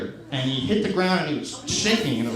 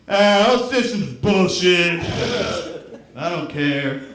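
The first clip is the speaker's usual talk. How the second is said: fake cynical; 3 dB louder, much slower than usual. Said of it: "I just pretend I'm really cynical".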